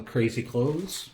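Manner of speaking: ordinary delivery, nothing marked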